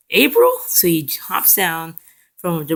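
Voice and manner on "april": high, loud questioning cry; startled recognition. Retold or imitated. Mikey hears his friend scream, high surprised question. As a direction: act out sudden surprise, pitched way up.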